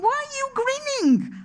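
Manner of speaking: high-pitched exasperated question, retold; worked up. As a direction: ask it in worked-up disbelief, pitch way up. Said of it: "I'm getting so worked up".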